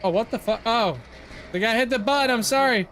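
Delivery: loud, high, shocked yelling; shocked outburst. Real, not acted. Gamer shocked a hidden player hit the button, yelling loud and high. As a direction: burst out in disbelief. Shocked at a teammate's surprise move.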